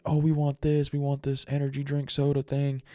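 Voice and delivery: high, eager mock kid voice; a lightly voiced chorus of kids asking for drinks; kids begging. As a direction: imitate excited kids pleading for soda. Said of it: "they're like".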